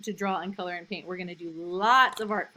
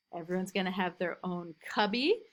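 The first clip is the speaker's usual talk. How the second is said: ordinary delivery, nothing marked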